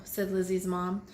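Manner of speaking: usual speaking voice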